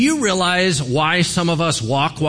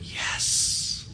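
unvoiced relieved whisper; a secret whispered yes of relief; 10 dB quieter than usual. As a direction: breathe the yes out, barely voiced. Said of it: "Elisha was going".

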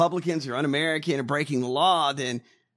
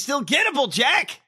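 calling out; 10 semitones higher, 5 dB louder than usual. Real, not acted. Calling out to Jack Smith.

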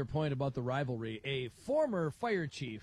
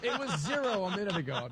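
laughing; 4 semitones higher, 3 dB louder than usual. Real, not acted. Joking with laughter.

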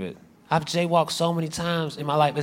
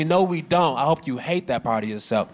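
ordinary talk, nothing marked

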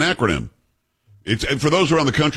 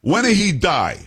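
higher-pitched, imitated Italian woman; thick Italian accent; 7 semitones higher than usual. Bold woman asks about his grandfather, mock Italian accent. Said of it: "with a thick, uh, um, Italian accent".

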